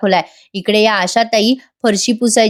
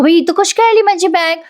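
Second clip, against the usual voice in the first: higher-pitched, mildly panicked questioning; flustered alarm; 7 semitones higher than usual. Acted. Aunt finds her bag fallen, flustered alarm. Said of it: "एवढं घाबरायला काय झालं तुम्हाला".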